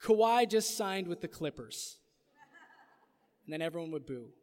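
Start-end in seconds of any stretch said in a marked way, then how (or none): 0.0-2.0 town crier. Acted. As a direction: proclaim the news to the square. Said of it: "They would say".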